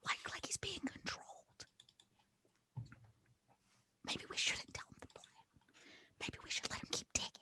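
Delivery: hushed, secretive whisper to the party; conspiratorial whisper. Pretend. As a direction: whisper the secret inside the huddle. Secret huddle away from compromised arm.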